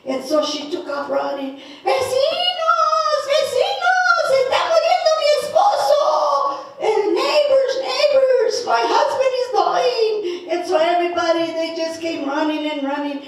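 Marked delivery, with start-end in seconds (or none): 1.8-10.5 very high, loud, sing-song cry to the neighbors; calling out. Acted. Wife running to call the neighbors, high panicked calling. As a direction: call out across the village in a panic.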